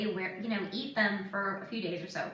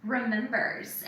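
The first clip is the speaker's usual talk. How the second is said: normal talking voice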